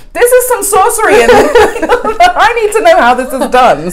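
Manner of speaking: high, loud, laughing wonder; awed demand. Retold or imitated. To the baristas, begging to learn their sorcery, high and laughing. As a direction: insist on learning the secret. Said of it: "I was like".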